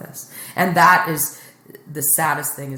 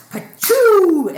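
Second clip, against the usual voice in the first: high, loud "pa-choo" sound effect; imitated whoosh noise; 12 dB louder than usual. Comedian describing a quick abortion, imitated whoosh. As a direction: fire off a high zooming noise.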